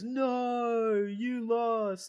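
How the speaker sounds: a hammed-up childish Hangman; mock Hangman. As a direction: whine it up high like a sore loser. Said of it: "he goes".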